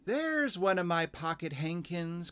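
put-on high little-girl cry of discovery; excited cry. A narrator's reading. Girl spotting her lost hankie, high excited cry. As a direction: call it out high, as a delighted child. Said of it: "cried Lucy".

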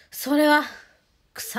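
それは said loud and high, a pause, then 草; pause before 草. Shown as a demonstration. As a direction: leave a gap after それは before 草. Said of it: "ちょっと間空けてから".

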